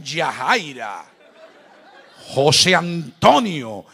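slow, laughing mock call of names; kids mimicking. Children mimic parents calling each other, slow with laughter. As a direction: drag the names out like teasing kids.

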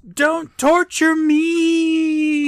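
drawn-out high-pitched yell; loud yell. Acted. Prisoner to his torturers, loud pleading yell. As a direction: yell it long and high, stretching every word. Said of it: "He loudly yells".

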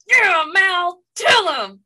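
loud high cheer; root for him like at a fight. Retold or imitated. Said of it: "And I was like".

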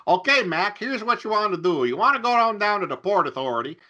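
put-on high, brash New York cabbie accent. Cabbie answers a tourist asking for the subway, New York accent. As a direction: play a streetwise New Yorker giving directions in a New York accent. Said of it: "that's my inexplicable New York accent".

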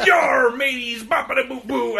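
loud, high pirate holler; mock pirate call into nonsense syllables; pirate patter. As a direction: play a hammed-up pirate. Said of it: "he's like".

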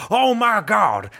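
mock-shocked ringside commentator outburst; hint of an overwrought wrestling call; J R impression. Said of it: "what's that J R is that wrestler announcer's name".

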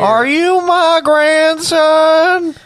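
very high, loud, wailing granny; hammed-up shrieky old lady; nursing-home old woman. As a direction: stretch every word in a high granny quaver. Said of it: "the old women in the nursing home".

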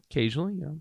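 sheepish admission. Retold or imitated. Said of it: "And I'm like".

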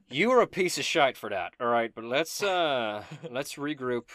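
hearty in-character reproach; mock scolding. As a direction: scold a friend, then move on. Healed character scolds his friend.

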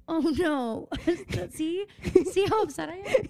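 laughing. Real, not acted. Laughing at herself to a friend after stepping on a can. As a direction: talk through giggles.

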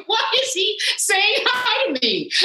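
slow, very high incredulous squeal; mock bewildered panic. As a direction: stretch it out in high-pitched acted disbelief. Retold inner panic at greeting.

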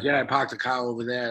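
dropped-r Boston accent, "car" as "cah"; lazy Boston accent, r's swallowed. Shown as a demonstration. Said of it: "when you talk with a Boston accent".

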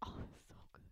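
hushed, breathy reaction; whispered delight. Retold or imitated. Recalling her reaction to a funny answer, whispered. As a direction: whisper it like a secret thrill. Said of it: "I was like".

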